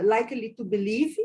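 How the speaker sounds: normal talking voice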